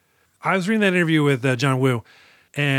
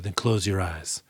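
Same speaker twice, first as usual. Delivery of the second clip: soft hush; 7 semitones lower, 6 dB quieter than usual. Retold or imitated. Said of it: "And he's like".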